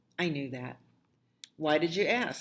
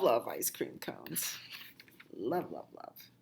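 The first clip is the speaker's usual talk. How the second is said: whisper; 6 semitones lower, 8 dB quieter than usual. Acted. Child trails into whisper.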